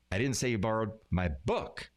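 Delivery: "book" set apart and punched at the end; stress on BOOK. Shown as a demonstration. Teacher showing how emphasis changes meaning, stress on "book". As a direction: hold back, then hit the final word "book". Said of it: "putting emphasis on different words".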